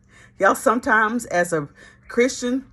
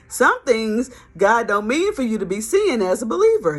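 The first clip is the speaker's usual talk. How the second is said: ordinary talk, nothing marked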